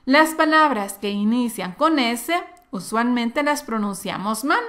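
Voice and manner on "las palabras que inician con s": usual speaking voice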